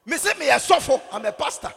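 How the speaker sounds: high, very loud insistence; emphatic shout. Retold or imitated. Pastor retelling his answer to the high court judge rumour, shouted emphatically. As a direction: shout it to settle the matter.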